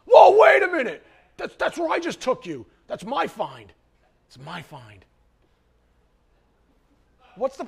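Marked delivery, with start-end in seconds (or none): 0.1-1.1 high, loud, indignant whoa; indignant shout. Retold or imitated. Friend claiming the ice cream find. 1.4-5.0 possessive friend. Retold or imitated. Friend claiming the ice cream find.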